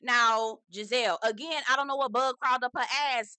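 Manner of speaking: usual speaking voice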